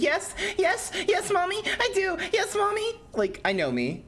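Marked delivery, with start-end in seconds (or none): 0.0-3.0 high, breathless eager begging; much higher pleading voice; desperate yes mommy. Pretend. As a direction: whimper it like a smitten fan. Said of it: "I'd be like".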